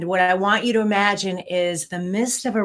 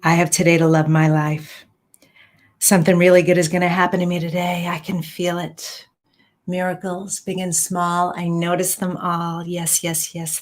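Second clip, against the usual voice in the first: affirmations; 4 dB louder than usual. Acted. Recites morning affirmations.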